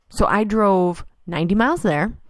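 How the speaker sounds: normal talking voice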